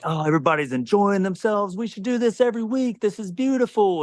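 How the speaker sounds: high, gushy film-scene impression; mock gushing. Acting out a film character's gushing.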